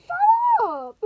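high, loud snap of Shut up!; sudden shout. Acted. Snapping back during the argument.

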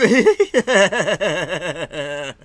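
loud, long rolling laugh; helpless burst of laughter, tapering off; real belly laugh. Real, not acted. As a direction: crack up loudly at your own joke. Cracking up at own joke.

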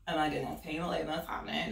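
ordinary delivery, nothing marked

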